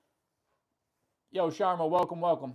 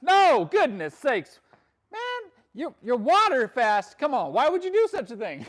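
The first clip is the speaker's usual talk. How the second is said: high, loud, slow disbelief; loud high disbelief at a viewer's water fast plan; incredulous; 7 semitones higher, 7 dB louder than usual. Real, not acted. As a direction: react loudly in disbelief, drawing it out. Reacting to water fast question.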